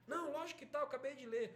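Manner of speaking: high, exasperated insistence; angry father. Acted. Husband arguing with his wife over telegram, exasperated. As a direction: insist indignantly, acted out. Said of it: "ele fica bravo".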